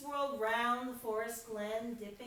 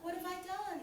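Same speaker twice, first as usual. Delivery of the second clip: sighing; 7 semitones higher, faster than usual. A narrator's reading. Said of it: "he said with a sigh".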